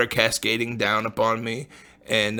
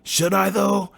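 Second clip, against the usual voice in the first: high, unsure mock question; doubtful squeak; 8 semitones higher, slower than usual. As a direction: squeak it out like a nervous stoner. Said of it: "And I'm like".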